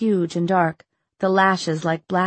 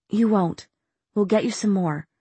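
confident; 3 semitones higher than usual. A narrator's reading. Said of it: "with more confidence than she felt".

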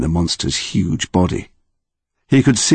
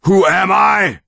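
roaring monster; 13 semitones higher, slower than usual. Acted. Monster lifting Connor into the wind, roaring. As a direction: bellow it at the boy held aloft. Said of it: "still roaring".

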